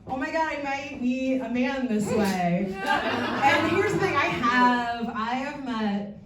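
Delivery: normal talking voice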